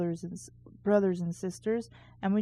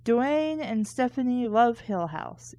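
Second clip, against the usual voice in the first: ordinary delivery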